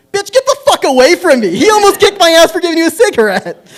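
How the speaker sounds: high, loud, sudden screaming rant; furious yell. Retold or imitated. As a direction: explode at her after the calm buildup.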